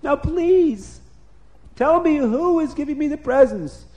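high, excited gushing; overjoyed king. A narrator's reading. King jumps up and down over presents, overjoyed. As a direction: gush through the list like a delighted child. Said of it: "the king was jumping up and down".

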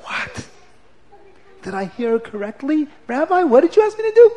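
high, fast, incredulous question; the boy can't believe his ears; stunned disbelief. Acted.

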